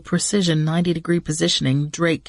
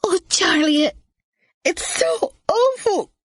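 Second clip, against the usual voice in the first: high tearful wail breaking into tears; crying; 14 semitones higher than usual. Acted. Maggie to Charlie after the crash, crying. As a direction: let the tears break the words. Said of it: "She burst into tears".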